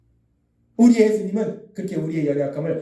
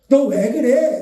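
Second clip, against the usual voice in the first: louder, higher acted scolding; 4 semitones higher, 5 dB louder, much slower than usual. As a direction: act out telling someone off. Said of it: "혼내키시는 것이 아니라". (혼내듯 높고 크게 다그치는 말투)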